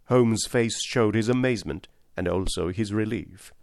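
ordinary delivery

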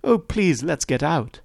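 high, pleading woman's voice; distraught woman's cry. A narrator's reading. Daisy begs to leave the quarrel, distraught. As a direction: beg in a high, upset voice. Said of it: "cried Daisy".

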